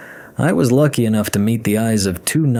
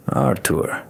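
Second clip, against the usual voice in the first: Artur with the second syllable stressed; stress on last syllable; 3 dB quieter than usual. Acted. Man introduces himself to train companion, Artur stressed on last syllable. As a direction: lean on the -tur of Artur. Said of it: "with the stress on the last syllable".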